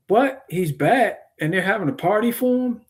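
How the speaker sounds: put-on high-pitched, indignant questions as the older brother; retold anger. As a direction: act out an affronted brother, pitch jumping up. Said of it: "he was angry".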